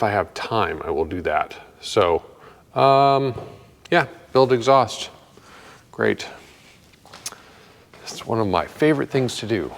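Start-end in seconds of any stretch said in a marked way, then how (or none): 5.9-9.7 deadpan sarcasm, a little softer; sarcastic. Real, not acted. Builder grumbling to viewers about exhaust work, deadpan sarcastic. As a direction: say it drily, without any real enthusiasm. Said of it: "that was sarcasm".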